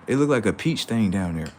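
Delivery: ordinary talk, nothing marked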